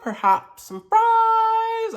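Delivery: high and slow, drawn out. Acted. Drawn-out high mock-posh aside.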